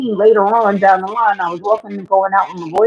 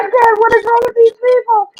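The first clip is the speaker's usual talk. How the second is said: higher, incredulous whine; high disbelieving squeal; 12 semitones higher than usual. Retold or imitated. Girl about to be jumped squeals to her dad in a high voice. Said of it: "I'm like".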